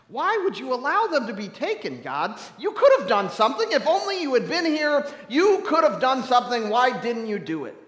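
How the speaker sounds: loud, high, impassioned outcry; anguished accusation. Acted. Bereaved parent demanding answers from God, anguished. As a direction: hurl the reproach at heaven.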